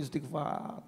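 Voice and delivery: ordinary talk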